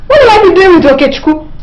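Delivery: tearful, fast, high protest; crying denial. Acted. Wife accused of cheating, crying in protest. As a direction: sob out the indignant denial.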